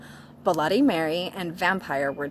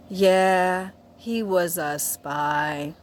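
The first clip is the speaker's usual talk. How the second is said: slow, drawn-out mock sorrow; hammed-up sad voice; sadly; much slower than usual. A narrator's reading. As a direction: stretch the words out mournfully. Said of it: "Sirius said sadly".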